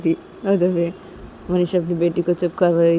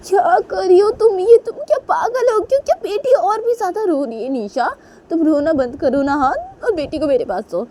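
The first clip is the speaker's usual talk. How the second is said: high-pitched exasperated outburst, starting loud; frantic scolding; 12 semitones higher, 3 dB louder than usual. Acted. Manisha demands the baby from crying Nisha, frantic scolding.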